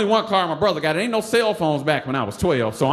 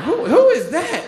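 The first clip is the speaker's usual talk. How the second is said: put-on high, louder, slower squeal of a nosy girl; drawn-out giggly who is that; curious girl; 12 semitones higher, 5 dB louder than usual. Girls asking about the ninja.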